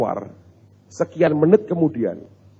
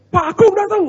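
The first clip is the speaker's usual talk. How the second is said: high, loud imitated yell of alarm; shout; 7 dB louder than usual. Resident spots the pastor outside and yells, imitated high shout. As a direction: cry out the visitor's arrival in a raised, high voice. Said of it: "Terus teriak".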